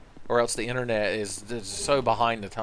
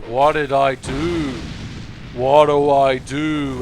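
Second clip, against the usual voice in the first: slow, puzzled, a bit louder; lightly voiced confused NPC; 5 dB louder, much slower than usual. Companion unsure what to do in the fight, slow confused voice. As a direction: dawdle over the words like a lost sidekick. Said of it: "Bashful Ray's like".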